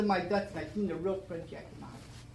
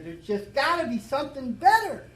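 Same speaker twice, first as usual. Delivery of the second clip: plea to God, much higher and louder; retold cry to God; 8 semitones higher, 8 dB louder than usual. Man in a bar crying out to God for something better, higher and louder plea. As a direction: cry it out, begging for a way out. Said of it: "And I cried out".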